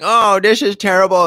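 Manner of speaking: hammed-up mock alarm; mocking Ben Shapiro. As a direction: lampoon a pundit's fake shock. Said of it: "And he's like".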